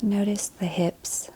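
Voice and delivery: calm body-scan voice, short phrases between long silences; guided meditation. Acted. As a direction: lead them gently through the body, leaving space to breathe.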